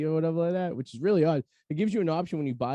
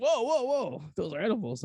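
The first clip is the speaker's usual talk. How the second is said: alarmed warning; 9 semitones higher than usual. Retold or imitated. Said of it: "He's like".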